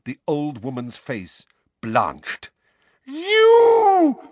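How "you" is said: very high, loud shocked howl. A narrator's reading. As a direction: howl at the sight of him. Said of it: "she howled".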